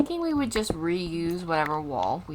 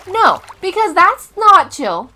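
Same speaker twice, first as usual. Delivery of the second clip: high, loud, disbelieving rant; exasperated shouting; 11 semitones higher, 13 dB louder than usual. Real, not acted. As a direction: yell it, refusing this cave. Reacting to creeper spawn point.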